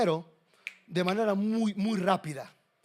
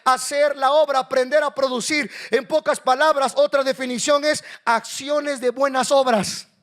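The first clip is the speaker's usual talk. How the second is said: higher, louder preacher's voice; raised pulpit delivery; emphatic preaching; 6 semitones higher, 6 dB louder than usual. Real, not acted. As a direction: preach it out with force.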